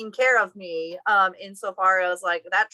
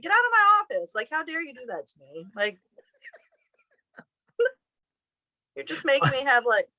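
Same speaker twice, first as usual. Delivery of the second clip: mock yell; 7 semitones higher than usual. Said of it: "And then I yelled at him".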